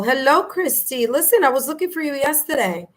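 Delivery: ordinary talk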